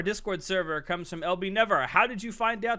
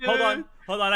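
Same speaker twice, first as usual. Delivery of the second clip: ordinary delivery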